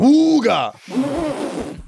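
very slow, high stretched horn honk imitation; old car horn. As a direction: mimic a vintage car horn. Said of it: "how come horns back in the day went".

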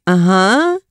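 flat, doubtful "uh-huh"; lightly voiced awkward relative; unimpressed. Aunt and uncle unimpressed reply.

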